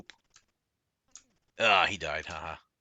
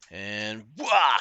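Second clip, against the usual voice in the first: yell; 3 semitones higher, 10 dB louder than usual. Real, not acted. Gamer yells attacking.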